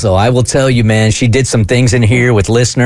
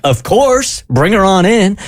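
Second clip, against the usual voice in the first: eager yes; 8 semitones higher, slower than usual. Retold or imitated. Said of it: "So I'm like".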